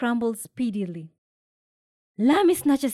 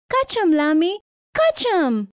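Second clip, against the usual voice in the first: high, louder cheering call; shout; 9 semitones higher, 6 dB louder than usual. A narrator's reading. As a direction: urge her on loudly. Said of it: "shouts Sister Sadia".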